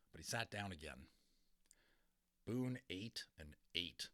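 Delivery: ordinary delivery, nothing marked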